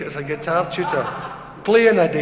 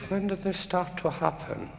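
a mild mock Japanese accent, softer; 10 dB quieter than usual. Japanese doctor asks deaf patient, lightly imitated Japanese accent. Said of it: "In a Japanese accent".

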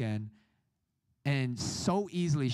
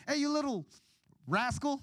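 high-pitched mock telling-off; scolding mother; 10 semitones higher than usual. Mom rousing her son for church, high playful scolding.

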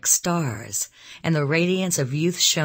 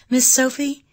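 high, louder sudden address; abrupt; 12 semitones higher, 6 dB louder than usual. A narrator's reading. Elizabeth to Sophie by the fire, abrupt and high. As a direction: break in suddenly with the name, pitched up. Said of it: "she said abruptly".

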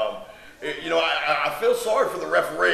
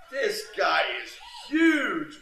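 excited; 8 semitones higher, slower than usual. Real, not acted. Commentator hyping huge wrestler.

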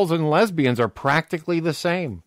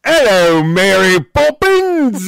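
loud, drawn-out mock Cockney, higher; bad Mary Poppins Cockney; 4 semitones higher, 8 dB louder, much slower than usual. Imitating the film character for co-host, loud hammy Cockney. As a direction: belt it out in exaggerated Cockney. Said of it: "almost as bad as your, um, your Mary Poppins".

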